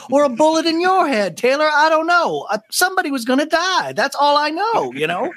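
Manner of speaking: high, flippant threat; mock dark [name]. [name] threatening Taylor, high flippant. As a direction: toss it off darkly. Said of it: "then I was like".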